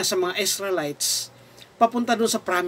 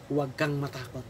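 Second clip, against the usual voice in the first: soft, low and tender; calm, lowered voice of reassurance; gentle reassurance; 6 semitones lower, 10 dB quieter than usual. Retold or imitated. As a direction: quote it as a hushed comfort. Preacher voices God's reassurance.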